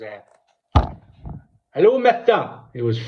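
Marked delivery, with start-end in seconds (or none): 1.7-2.6 high, silly voice of a hapless caller; high mock Davey voice. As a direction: lightly play the clueless local ringing the police. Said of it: "he phones the police and he goes".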